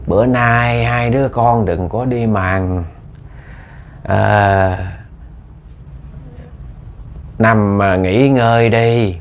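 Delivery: a possessed mother luring them to stay home, lightly voiced; slow eerie coaxing; eerie demon mother. A narrator's reading. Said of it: "cái giọng nói nó kỳ lắm, rụng rợn lắm".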